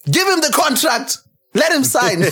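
high, excited demand in imitation; mock-urgent pundit plea, pitched well up; Rio Ferdinand. Football fan imitating a pundit's viral rant, excited and high. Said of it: "that Rio Ferdinand video of".

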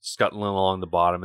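ordinary delivery

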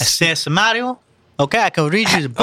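high, exaggerated Italian accent; mock Italian accent. Shown as a demonstration. Reader showing co-hosts the accent on offer, hammed-up Italian accent. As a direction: ham up a cartoon Italian. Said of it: "I can do an accent".

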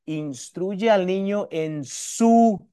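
slow build to a stretched SU, then cut the phrase; énfasis en SU. Shown as a demonstration. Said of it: "¿Cuál es el su?". (SU alargado y recalcado al final)

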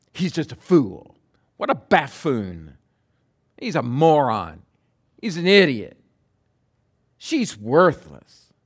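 scornful name-calling, falling hard on each insult; mock contempt. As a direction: imitate someone dismissing people with disdain. Mimics people insulting others.

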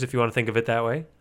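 ordinary talk

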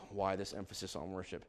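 very soft, low musing; hushed pondering. Real, not acted. Preacher pondering his own question, hushed and low. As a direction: drop right down, as if thinking aloud. Question repeated quietly to himself.

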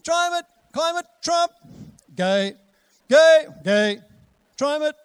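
high, loud yapping chant; news barking like dogs. Retold or imitated. Preacher mocking the news as barking dogs, high loud yaps. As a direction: bark the headlines like a neighbourhood dog. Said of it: "If you listen to the A B C".

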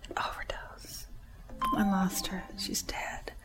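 whispered, breaking off short; barely voiced, holding back tears; hushed grief. Real, not acted. Telling the church of the woman she lost, hushed whisper.

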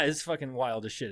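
normal talking voice

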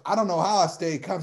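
usual speaking voice

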